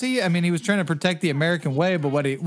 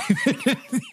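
laugh; 7 semitones higher than usual. Real, not acted. Laughing after impression.

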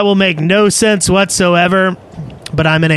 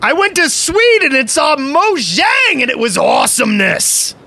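reading a donor message; 7 semitones higher than usual. Acted. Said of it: "who says".

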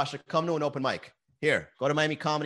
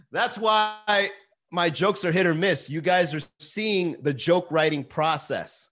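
ordinary delivery

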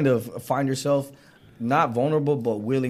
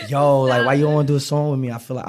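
louder, fast imitation of an East Coast accent; 8 dB louder than usual. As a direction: mimic her East Coast slang delivery. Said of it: "she talked like this".

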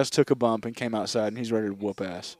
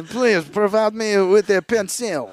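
slow, higher mock-French; French accent; 6 semitones higher, 3 dB louder than usual. Frenchman asking for writing things, slow hammy French accent. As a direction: request it in a drawn-out French accent. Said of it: "He's French".

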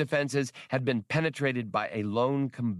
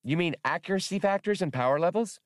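put-on high, quick eager kid droid; childish voice; 8 semitones higher, faster than usual. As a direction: rattle it off like a keen child. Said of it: "Max asked in his childish voice".

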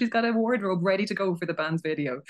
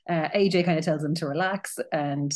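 ordinary talk, nothing marked

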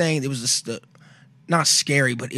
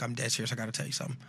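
much softer, low and drowsy; groggy; 4 semitones lower, 14 dB quieter, faster than usual. Retold or imitated. Sister just out of a coma, softly groggy. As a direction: barely get it out, half asleep. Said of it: "all groggy".

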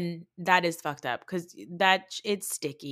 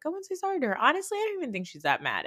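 much higher, fast mock voice; mocking Meredith; 10 semitones higher, faster than usual. Mocking Meredith urging Jen to apologize, high and quick. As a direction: squeak it out like a dismissive friend. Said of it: "Meredith is like".

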